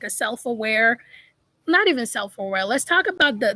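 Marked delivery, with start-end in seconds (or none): none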